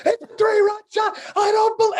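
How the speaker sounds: high shouted home run call; imitating an announcer screaming a homer; announcer going wild. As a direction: burst out like the announcer who just lost it. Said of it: "it all comes out of him in one shot".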